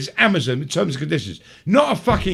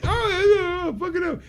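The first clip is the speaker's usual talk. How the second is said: excited gush; 10 semitones higher, 3 dB quieter than usual. Retold or imitated. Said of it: "I went".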